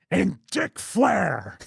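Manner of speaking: slow, growly grizzled veteran snarl; grizzled wrestler growl; gravelly Shane Douglas voice. Retold or imitated. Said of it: "The only time it ever came out was like".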